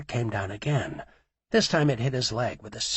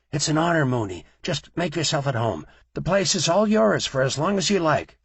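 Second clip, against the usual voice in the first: louder, higher eager flattery; fawning owners; 5 semitones higher, 6 dB louder than usual. A narrator's reading. Gas station owners fawning over Mooney, louder and higher. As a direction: gush over the boss.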